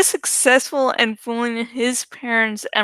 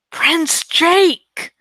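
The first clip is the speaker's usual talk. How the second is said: higher, louder sharp call of his name; sharp snap; 5 semitones higher, 4 dB louder than usual. A narrator's reading. Ax snapping at Prince Jake to stop, sharp. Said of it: "I snapped".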